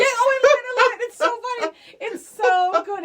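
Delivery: high, loud delighted gushing; excited squeal. Real, not acted. Friend raving about the office scene, excited high squeal. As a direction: squeal with joy over the scene.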